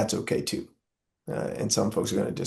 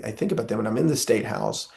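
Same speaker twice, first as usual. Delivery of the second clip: normal talking voice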